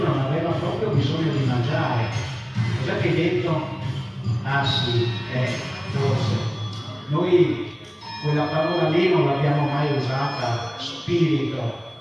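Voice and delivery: usual speaking voice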